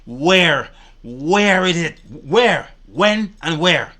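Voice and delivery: loud, hammered demand, slowing on the last; demanding proof, loud and slow at the end; angry loud demand. Real, not acted. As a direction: shout where it is, angry.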